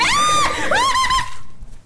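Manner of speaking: high shrieking laugh; sudden high nervous laugh; burst of laughter. Real, not acted. Laughing at game scare.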